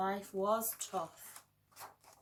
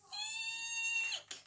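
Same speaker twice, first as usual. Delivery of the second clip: tiny squeaking eek, very high; the mighty lion lets out a mouse-like squeak; lion's eek. Acted. Lion lets out a tiny frightened eek at the mouse. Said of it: "let out an".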